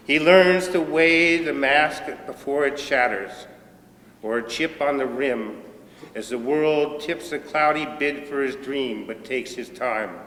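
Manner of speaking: measured recital, strong attack on opening lines; poetry reading. Acted. Poet reads an old poem about a bartender friend, deliberate recital. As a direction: declaim the verse slowly, phrase by phrase.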